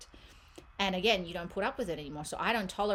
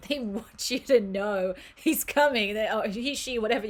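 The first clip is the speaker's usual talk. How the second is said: laughing delivery; 3 semitones higher, 6 dB louder than usual. Real, not acted. Reader relaying the cards' insistent news, laughing. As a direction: announce it while cracking up.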